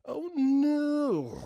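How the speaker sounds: high, drawn-out wail of dismay; distressed whine. Retold or imitated. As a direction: wail it high, as if asleep and upset. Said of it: "Distressed whining noises".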